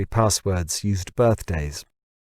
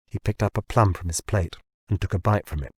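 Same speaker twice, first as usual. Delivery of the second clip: ordinary delivery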